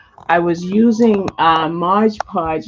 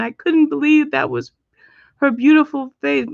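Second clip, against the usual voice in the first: real emotion, higher voice that catches; voice catching; 7 semitones higher than usual. Real, not acted. Mother recalling her daughter coming home from hospital, voice catching. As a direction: choke up with love mid-sentence. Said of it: "my voice just caught".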